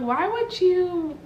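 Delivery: high, drawn-out mock protest; incredulous objection. Girlfriend questioning boyfriend's detour, incredulous.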